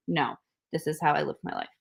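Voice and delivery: ordinary talk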